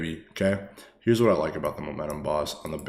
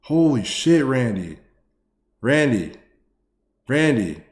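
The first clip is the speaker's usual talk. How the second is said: excited calling; 5 semitones higher, 7 dB louder, slower than usual. Real, not acted. Calling out donor's name excitedly.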